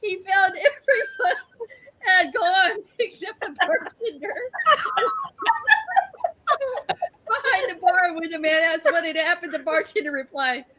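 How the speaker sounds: laughing. Real, not acted. Reading joke aloud, cracking up.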